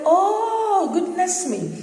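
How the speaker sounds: high-pitched, drawn-out exclamation; long high "oh" in a mock scream, easing down; retold scream. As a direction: re-enact a squeal of astonished realization. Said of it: "you know, I screamed".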